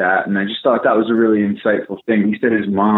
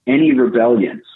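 usual speaking voice

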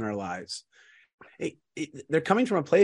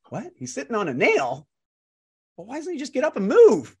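put-on incredulous young man; 8 semitones higher than usual. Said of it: "The young man's like".

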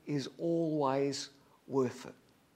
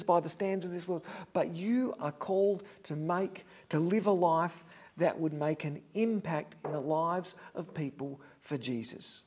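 ordinary talk, nothing marked

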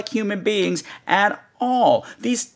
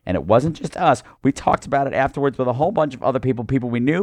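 usual speaking voice

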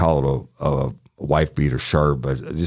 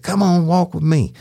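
high-pitched imitation of an angry, cursing woman; Miss Mary imitated; 14 semitones higher, 3 dB louder than usual. Deputy retelling the woman yelling back at him, high mock-angry voice. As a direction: squeak her up high, all attitude.

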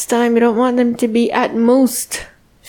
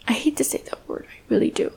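breathy confiding whisper, much softer; whispered aside; 2 semitones higher, 9 dB quieter, much faster than usual. Real, not acted. To viewers, hating to say the word, whispered aside. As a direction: drop to a whisper as if the word pains you.

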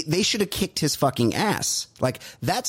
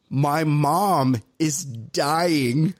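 slow retold line; much slower than usual. Claire's line retold slowly.

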